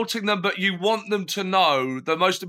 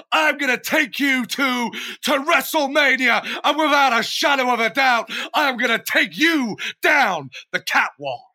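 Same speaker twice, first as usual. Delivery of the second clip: higher, louder shouted wrestler trash talk; wrestling promo voice; 7 semitones higher, 3 dB louder than usual. Pretend. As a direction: bellow it like a WWF heel cutting a promo. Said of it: "From Sean Fashion".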